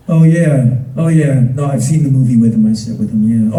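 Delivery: low, loud dopey voice; burly friend. Retold or imitated. Burly friend suddenly remembering the movie, low.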